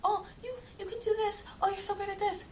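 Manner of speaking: a parody of mom's encouragement; mocking sweet mom. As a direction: coo it like an over-eager mom. Mom cheering her on, quoted.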